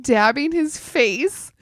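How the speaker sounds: usual speaking voice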